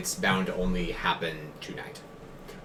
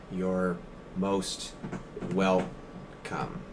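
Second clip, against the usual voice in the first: weirded out; 2 semitones lower, 7 dB quieter, much slower than usual. Acted. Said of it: "He nods a little bit weirded out".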